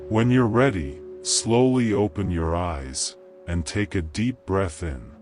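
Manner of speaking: calm, unhurried meditation voice with long pauses; guided meditation. Acted. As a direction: gently guide the listener into calm.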